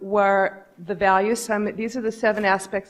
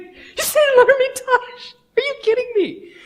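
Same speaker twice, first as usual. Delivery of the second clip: much higher, louder, laughing disbelief; 6 dB louder than usual. Retold or imitated. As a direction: shriek it in amused outrage. Boss hears of luxury hotel.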